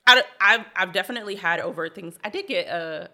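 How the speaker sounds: ordinary talk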